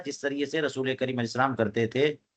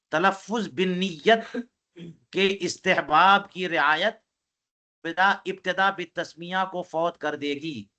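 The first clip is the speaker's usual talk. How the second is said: slow emphatic dictation; slower than usual. Shown as a demonstration. Teacher stresses a key ruling.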